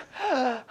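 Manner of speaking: sharp acted gasp surfacing from water; gasp for air. Drowning man coming up for air.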